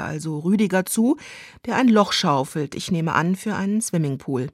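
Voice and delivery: normal talking voice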